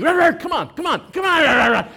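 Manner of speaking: high, loud mock barking; little dog barking. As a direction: bark like a brave little dog taking on a pit bull. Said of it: "Starts barking at this dog".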